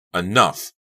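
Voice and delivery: loud one-word outburst; fed up. A narrator's reading. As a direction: snap that you've had it. Fed-up inner outburst of Becca.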